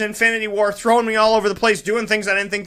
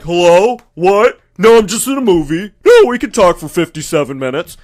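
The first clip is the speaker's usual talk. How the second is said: loud, careless phone chatter in the cinema; mock a rude moviegoer on the phone; loud phone talker; 6 dB louder than usual. Mocking a moviegoer who takes a call during the film, loud and careless. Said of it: "the idiots that answers their phone".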